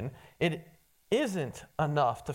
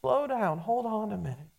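softer, calm and caring, slowing her down; 3 semitones higher, 4 dB quieter than usual. Retold or imitated. Jesus calmly stopping worried Martha, soft and caring. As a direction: gently settle a panicked friend. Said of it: "he just stops and he calmly says".

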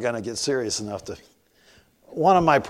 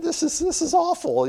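high dismay. Retold or imitated. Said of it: "I said".

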